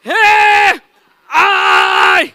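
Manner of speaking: high, loud shouted reply. Shown as a demonstration. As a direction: yell it out like a cheer. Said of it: "एक बार try लेते". (ऊँची आवाज़ में ज़ोर से चिल्लाना)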